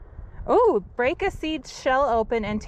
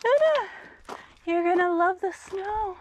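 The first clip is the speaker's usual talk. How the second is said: excited; 9 semitones higher than usual. Real, not acted. Excited talking to dog.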